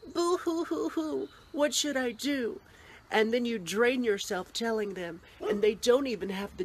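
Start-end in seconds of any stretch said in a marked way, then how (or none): 0.1-2.6 mock whining. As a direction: fake crybaby boo hoo. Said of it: "when someone says".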